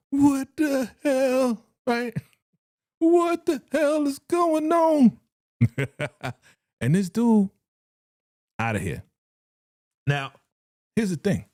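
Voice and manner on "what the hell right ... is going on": high, drawn-out mock bewilderment; acted disbelief. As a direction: stretch it out high in stunned disbelief. Acting out a stunned reaction.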